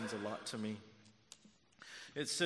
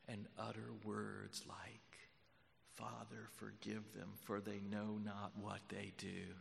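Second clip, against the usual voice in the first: grave near-murmur to a congregation; hushed, solemn preaching; 6 dB quieter than usual. Real, not acted. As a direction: drop right down and let each phrase hang.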